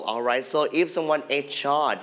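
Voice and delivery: normal talking voice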